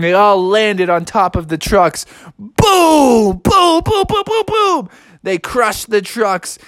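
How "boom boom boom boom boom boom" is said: high, rising volley of booms; playful high-pitched explosion noises; crash sound effects. Acted. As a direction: boom out the crashes higher and higher. Imitates trucks being crushed.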